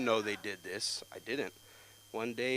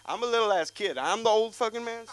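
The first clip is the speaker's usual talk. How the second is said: loud, high incredulous outburst; pitched-up, offended kid; indignant protest; 11 semitones higher, 9 dB louder than usual. Acted. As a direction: protest the nickname in disbelief. Kid indignant at being called old.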